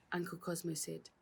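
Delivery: ordinary delivery, nothing marked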